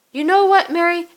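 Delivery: high, loud, confrontational; fed-up outburst. Acted. Caitlin turning to face Mary, fed-up outburst. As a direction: round on the friend, fed up. Caitlin snaps at pushy friend.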